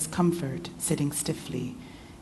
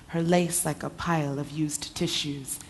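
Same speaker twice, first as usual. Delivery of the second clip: usual speaking voice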